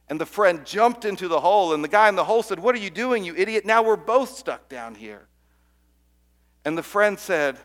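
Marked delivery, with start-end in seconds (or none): 2.6-5.3 high, loud exasperated yell; exasperated outburst. Acted. Trapped man yells at friend who jumped in, exasperated. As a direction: scold him for jumping in.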